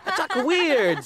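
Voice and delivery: high mock-classmate voice; Junior. Boy making coach laugh, high exaggerated impression. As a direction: pitch it way up to mimic the odd kid. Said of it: "Do a Junior".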